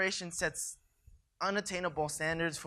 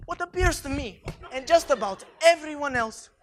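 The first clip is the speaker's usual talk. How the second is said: fairy godmother voice; 7 semitones higher, 6 dB louder than usual. Acted.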